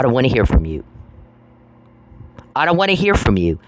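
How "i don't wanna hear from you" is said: raised pitch, falling hard at the end; fed up and telling someone to back off; real exasperation, pitched up; exasperated insistence. Real, not acted. Fed up, rebuking an unwanted contact.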